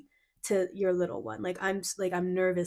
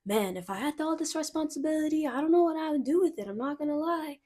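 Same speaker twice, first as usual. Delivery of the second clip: put-on higher, excited kid voice; little inner child; 7 semitones higher than usual. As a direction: lift the voice like a giddy child. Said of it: "Your little one is like".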